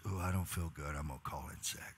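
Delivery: soft, droopy moaning; imitating an employee calling in sick; whiny sick employee. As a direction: sound pitiful and give up. Quoting employee feeling sick.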